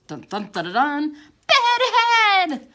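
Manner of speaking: rising dun-dun-dun into a loud high cry; dramatic reveal. A narrator's reading. Narrator unveiling the dreaded bedhead, building to a loud high cry. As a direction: build the drumroll, then blast the word out.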